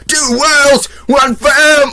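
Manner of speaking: hammed-up shrill mock singing shout. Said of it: "I hate [name]' voice".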